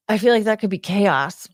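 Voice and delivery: ordinary talk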